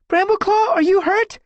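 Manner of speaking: very high, loud worried call. Acted. Squirrelpaw checking on Brambleclaw, worried call. Calling to injured companion.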